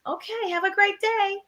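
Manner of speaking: high, bright friendly cashier greeting; cheery cashier. Retold or imitated. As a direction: sing out a sunny customer welcome. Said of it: "And she was like".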